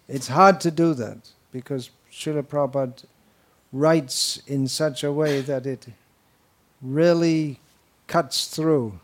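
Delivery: ordinary talk, nothing marked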